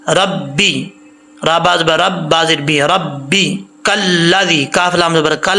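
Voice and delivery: ordinary delivery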